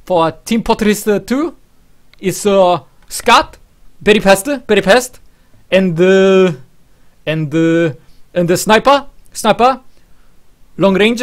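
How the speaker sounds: high, loud exaggerated Korean accent. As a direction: imitate a Korean gamer's broken English. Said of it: "Koreans are like".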